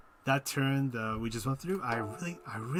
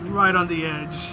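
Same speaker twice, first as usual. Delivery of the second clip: tense exclaim; 5 semitones higher, 7 dB louder, slower than usual. Real, not acted. Tense moment in race.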